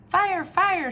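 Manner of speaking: high, loud shout; little F shouting fire. Acted. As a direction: shout the alarm. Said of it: "shouted the".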